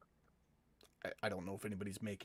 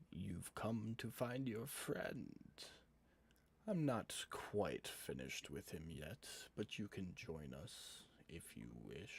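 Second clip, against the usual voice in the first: eerie echoing cave voice; 5 dB quieter than usual. Acted. Game master as unseen captor luring the party in, eerie slow whispery voice. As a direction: draw it out like a hidden villain in the dark. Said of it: "a voice echoes from the walls".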